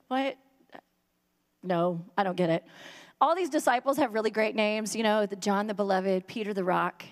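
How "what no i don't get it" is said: low, lost and confused; clueless disciple. Retold or imitated. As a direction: play dumb, utterly baffled. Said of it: "I'm like".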